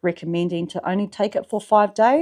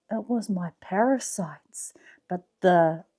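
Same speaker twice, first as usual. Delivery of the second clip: whisper; 4 dB quieter than usual. Acted.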